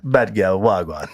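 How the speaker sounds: Jamaican accent that sounds Irish; bad Jamaican accent. Shown as a demonstration. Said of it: "My Jamaican accent sounds Irish".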